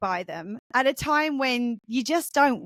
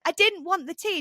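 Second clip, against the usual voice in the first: retold complaint, pitched up; mildly exasperated, higher-voiced refusal; protesting son; 7 semitones higher than usual. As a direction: push back like a fed-up teenager, lightly.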